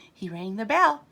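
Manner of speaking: usual speaking voice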